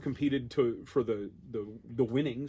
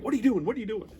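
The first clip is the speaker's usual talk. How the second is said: panicked; 8 semitones higher, faster than usual. Retold or imitated. Official runs over in panic.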